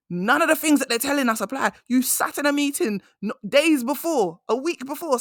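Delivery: high-pitched, incredulous venting; indignant. Real, not acted. Speaker calling out her boss's contradiction, indignant.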